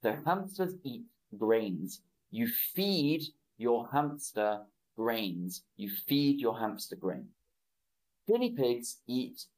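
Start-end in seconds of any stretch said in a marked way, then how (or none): 2.3-5.6 slow, clear model sentence, every word spaced out; slow modelling. Shown as a demonstration. Teacher modelling a sentence for online students, slow and clear. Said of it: "teacher repeats the model sentence". 5.8-7.3 fast, natural run-through of the sentence; now say it at full natural speed; normal-speed repeat. Shown as a demonstration. Teacher repeating the sentence at natural speed for students, brisk. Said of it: "teacher repeats the model sentence".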